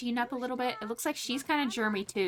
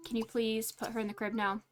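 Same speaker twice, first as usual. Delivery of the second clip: ordinary talk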